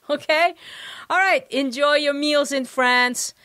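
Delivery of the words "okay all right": normal talking voice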